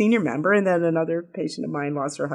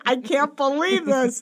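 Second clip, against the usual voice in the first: high, slowed, amazed disbelief; retold astonishment; 9 semitones higher than usual. Widow retelling her amazement at the salon, high and slow. Retold reaction to meeting Debbie.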